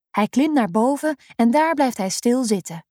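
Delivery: usual speaking voice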